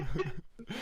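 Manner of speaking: helpless chuckle; laughing. Real, not acted. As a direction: crack up at your own story. Cracks up at own story.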